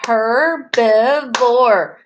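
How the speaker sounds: "Herbivore" very slowly, one beat per syllable; teacher chanting "Herbivore" syllable by syllable; clapped-out syllables. Shown as a demonstration. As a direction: split "Herbivore" into three spaced chunks. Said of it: "One more time".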